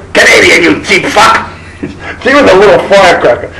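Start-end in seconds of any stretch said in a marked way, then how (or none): 0.1-1.5 mock tiny woman snapping at him; a small firecracker's put-on scold; feisty scolding. Twinkle mocking the cheap boyfriend, in a put-on scold.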